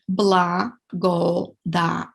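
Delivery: "Благодаря" stretched out slowly, syllable by syllable; slow it down so students can follow; slow syllable demo. Shown as a demonstration. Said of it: "Let's repeat".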